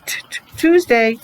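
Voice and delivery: t, t, then Tuesday clearly; sounding out t. Shown as a demonstration. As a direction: model the t before Tuesday. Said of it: "If you said t".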